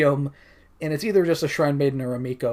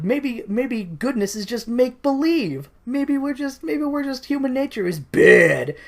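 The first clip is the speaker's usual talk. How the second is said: high brooding voice; 9 semitones higher than usual. Acted. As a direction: muse darkly in a high voice. Commoner's philosophy acted out.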